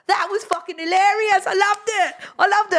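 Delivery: very high, delighted squeal; retold hilarity, pitch shot way up; hysterically amused. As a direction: imitate someone in stitches, gushing with glee. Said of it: "pissing himself laughing, just going".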